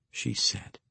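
plain reading voice

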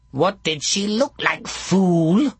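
high snarling crone. A narrator's reading. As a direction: snap it at him. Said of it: "snarled Warty".

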